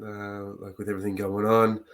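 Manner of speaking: ordinary delivery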